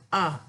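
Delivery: "A." repeated as a model for beginners; Tamil letter sound. Shown as a demonstration. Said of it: "A bunyinya".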